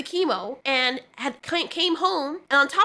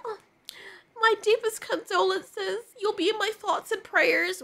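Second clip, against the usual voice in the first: put-on batshit old lady; 8 semitones higher than usual. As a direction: put on a batty old lady offering hollow sympathy. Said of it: "batshit old lady apparition being like".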